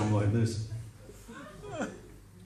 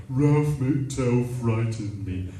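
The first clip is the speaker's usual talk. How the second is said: slow, drawn-out mock complaint; mocking mimicry; slower than usual. Girlfriend mocking his complaint back at him, slow and scornful. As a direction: parrot the complaint back slowly. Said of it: "she changed her tone of voice".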